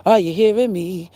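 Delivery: ordinary talk, nothing marked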